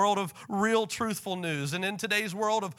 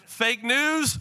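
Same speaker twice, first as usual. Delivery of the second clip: mock distraction; 6 semitones higher, 5 dB louder than usual. Acts out distractions versus Jesus.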